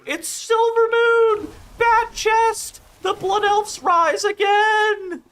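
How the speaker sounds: very high, slow, grand mock rallying cry; blood elf roleplayer. Mocking blood elf roleplayers with a high, slow triumphant cry. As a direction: proclaim it like a hammy fantasy zealot. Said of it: "They're like".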